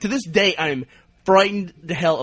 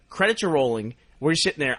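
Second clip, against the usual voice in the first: usual speaking voice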